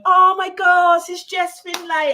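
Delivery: high, loud excited gasp of recognition; delighted surprise. Real, not acted. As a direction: exclaim as a familiar name pops up. Spots someone they know.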